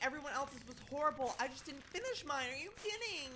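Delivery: high, loud cry of disbelief; can't believe the ranking; outraged disbelief. Real, not acted. Seeing ninth place in the results, outraged and high.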